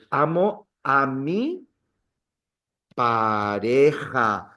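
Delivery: "pareja" slow with a tapped r and throaty j; Spanish model. Shown as a demonstration. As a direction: stretch out "Amo a mi pareja" slowly, Spanish r and j. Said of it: "So you would say".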